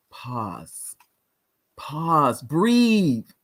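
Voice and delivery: "pause", "pause", "breathe" each set apart by a silence; first "pause" low and hushed, then "breathe" drawn out; deliberate pauses. Shown as a demonstration. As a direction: leave a clear gap after each "pause", then "breathe". Coach models pausing and breathing.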